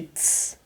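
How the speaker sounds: "ts" as a hissed T plus S; T released straight into S for "ts"; ts sound. Shown as a demonstration. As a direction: say "ts" as one joined consonant. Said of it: "ele vai ter som de".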